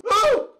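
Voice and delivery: high, loud acted "oh"; sudden high cry of alarm; startled yelp. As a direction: yelp it as if jolted upright.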